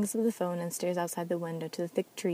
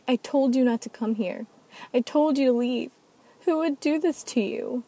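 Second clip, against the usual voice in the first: higher and louder, voice breaking with grief; crying outburst; 6 semitones higher, 7 dB louder than usual. Acted. Kylan sobbing alone in her car over the dead Ananiel, crying outburst. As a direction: sob it out at the one you lost. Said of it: "Kylan cries on the steering wheel".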